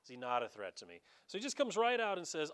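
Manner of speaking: ordinary talk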